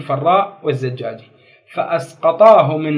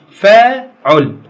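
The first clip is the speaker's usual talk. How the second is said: فاعل said very slowly and loudly; slow and drawn out; 4 semitones higher, 7 dB louder, much slower than usual. Shown as a demonstration. Teacher stressing the pattern for the class. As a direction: draw out فاعل loud and slow. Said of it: "شنو ميزان الصرفي؟".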